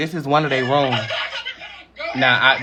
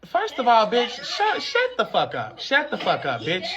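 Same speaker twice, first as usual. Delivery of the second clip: mock shrill yell; 14 semitones higher than usual. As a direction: shriek at them in a hammed-up high voice, laughing. Yelling at people in video.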